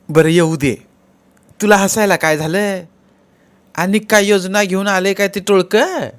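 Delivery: high, loud village woman; questioning sister-in-law. Acted. (चढ्या, मोठ्या आवाजात विचारणारी वहिनी)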